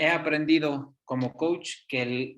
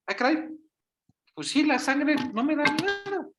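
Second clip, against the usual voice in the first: lightly voiced child, pitched higher, sudden realization; a hint of a child's voice, raised and relieved; relieved little girl; 11 semitones higher than usual. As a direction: imitate the girl's light-bulb moment. Child realizes blood isn't scary.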